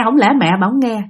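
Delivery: plain reading voice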